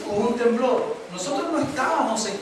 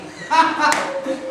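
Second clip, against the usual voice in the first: quick laugh; 6 semitones higher, 3 dB louder than usual. Real, not acted. Laughs after joke.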